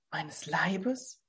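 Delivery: stunned, almost silent question; silent mouthing. A narrator's reading. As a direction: barely breathe the words in disbelief. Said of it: "bewegten sich lautlos die Lippen".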